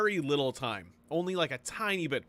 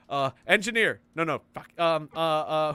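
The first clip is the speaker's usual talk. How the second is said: ordinary delivery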